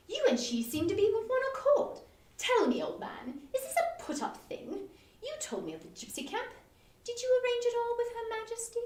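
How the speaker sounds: mock-serious teasing; bantering earnestness. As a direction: tease him with a straight face. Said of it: "said with an air of bantering earnestness".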